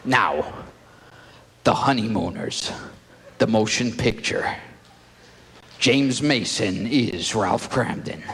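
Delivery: deep booming trailer read; trailer announcer. Acted. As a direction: announce the picture with grave weight.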